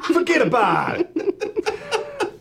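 put-on big, high-pitched New York accent, laughing through it; hammed-up New York accent; mock New York accent. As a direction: play a cartoonish New Yorker, voice pushed up. Jokey New York accent impression.

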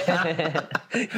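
high put-on giggle; imitated little giggle. Imitating her mom softening a refusal, high little giggle. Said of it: "little giggle after she says it".

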